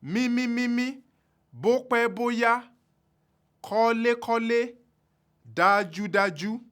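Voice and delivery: kọ́lé kọ́lé said high and level on all four syllables; dájú dájú held high throughout; four high tones. Shown as a demonstration. Teacher demonstrating four high tones, high and level. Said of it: "So again".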